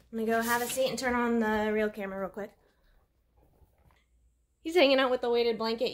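ordinary talk

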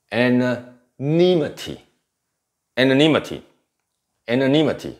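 Anonymity slowly, with NI drawn out, high and loud; stress on the later syllable. Shown as a demonstration. Teacher models a new word for students, stress on NI in Anonymity. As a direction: punch the NI in Anonymity, unhurried. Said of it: "重音节在后面".

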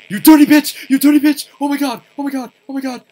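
very loud, high frightened shrieks; high-pitched yelling in real panic; panicked screaming. Real, not acted. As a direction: scream it while being chased. Screaming while chased by ghost.

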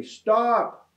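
high-pitched yell without full volume; narrator's acted shout; storybook yell. A narrator's reading. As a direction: yell it high, like a child protesting. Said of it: "Tyra yelled very loudly".

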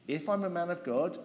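ordinary talk, nothing marked

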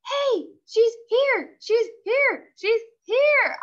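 high, loud, slow thrilled yelling. Acted. Junie B. spotting the Nana's car, high thrilled yells. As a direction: yell it again and again, overjoyed. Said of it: "I yelled, real thrilled".

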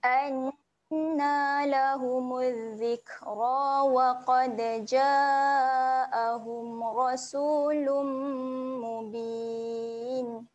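melodic chanted tilawah; Quran recitation. Acted. Teacher recites verse thirteen for students, chanted.

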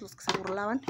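ordinary talk, nothing marked

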